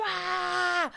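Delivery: high sudden retold scream. She screams on Avenida Paulista to prove herself, high yell. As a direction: let out a short scream in the street. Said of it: "Você tá disposta a gritar".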